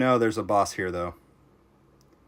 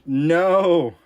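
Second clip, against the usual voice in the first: loud, high cry of despair; sudden louder, higher no; real shout of dismay; 8 semitones higher, 8 dB louder than usual. Real, not acted. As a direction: yell at the screen as it goes wrong. Character dies on screen.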